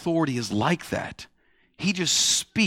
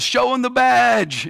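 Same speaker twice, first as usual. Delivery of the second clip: mocking farmer; 7 semitones higher, 3 dB louder, slower than usual. Farmer's punchline to the agent.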